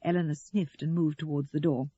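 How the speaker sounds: usual speaking voice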